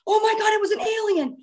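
high-pitched retold panic cry; retold scream. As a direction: imitate yourself screaming in alarm, without full force.

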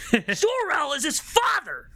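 high, shouted fanboy rant; mock outrage. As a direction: tell off the faker in hammed-up fury. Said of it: "turned to my sister and was like".

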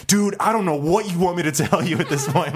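mock-exasperated plea, voice jumping high. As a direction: throw up your hands at the guy, laughing. Exasperated retold reply to manager.

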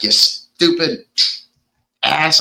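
mock-angry, splitting the phrase with a pause; mildly pissed off. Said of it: "He gets pissed off".

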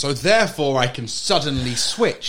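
hammed-up mock amazement, stretching the words; mock realisation. Podcaster mocking co-host's logic about dialects, exaggerated sarcasm.

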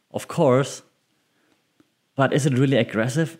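ordinary talk

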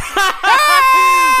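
high, loud burst of laughter; loud shrieking laugh. Real, not acted. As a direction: cackle hard. Cracking up at the joke.

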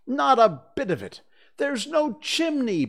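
put-on proud boy leader, high and bold; boastful boy voice, high and loud; Peter's voice, high and loud.

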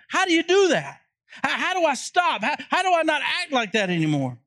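acted-out pleading question, pitched high; everybody's urgent question. Preacher voicing what everybody wants to know, urgent questioning. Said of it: "Here's what everybody wants to know".

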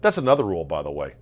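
normal talking voice

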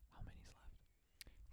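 real hushed, voiceless whisper. Real, not acted. Podcast host quietly checks with co-host how many are left, whispered. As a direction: whisper it under the others' talk. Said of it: "I could hear you guys whispering".

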